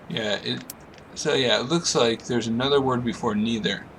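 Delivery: ordinary talk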